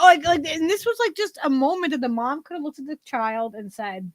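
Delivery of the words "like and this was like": ordinary talk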